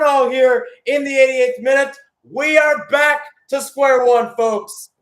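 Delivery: soaring, loud goal shout rising to a roar; excited goal call. Real, not acted. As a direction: scream the equaliser as it goes in. Goal call in live commentary.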